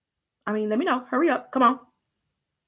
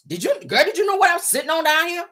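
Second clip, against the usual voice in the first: bragging man; 9 semitones higher than usual. Retold or imitated. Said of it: "they not bragging".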